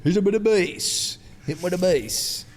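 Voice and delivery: lightly put-on bassy mate voice; mock bassy voice. Host teasing his co-host about his accent, mock bassy. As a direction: imitate your mate's voice with mock depth. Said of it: "got a bit more depth to it".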